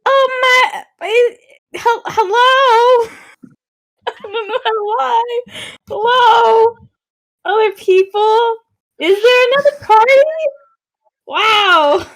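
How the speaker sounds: high-pitched, hammed-up surprise; Francesca. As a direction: call out in a put-on high voice. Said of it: "Francesca's gonna run to the door and be like".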